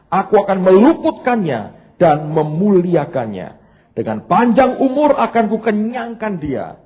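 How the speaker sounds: loud, high-pitched preacher's reading; scripture proclamation. Real, not acted. Reads scripture aloud at full force.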